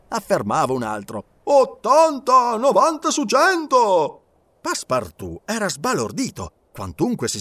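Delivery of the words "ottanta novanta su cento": high, loud, drawn-out call; excited outbidding. A narrator's reading. Passengers raising the odds of crossing, excited shout. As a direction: top the last bid, high and loud.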